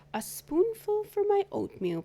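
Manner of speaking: high, chirpy little voice to the sugar bowl; cheerful Amos. Acted. Amos greeting the sugar bowl, high and cheery.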